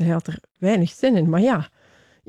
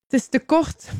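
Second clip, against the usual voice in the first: mild call up, higher in pitch; 8 semitones higher than usual. A narrator's reading. Figure at the well bottom to rescuer, mildly calling up. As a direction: call up a complaint without shouting. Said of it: "riep de vage figuur".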